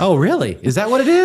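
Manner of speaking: high mock-amazed sarcasm; sarcastic. Real, not acted. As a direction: fake astonishment, teasing her. Husband teasing wife's obvious explanation.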